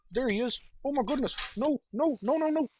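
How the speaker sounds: high, loud, panicked cries; startled panic. Real, not acted. Player spots the spider right behind him, panicked yelping. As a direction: yelp in alarm as it pitches way up.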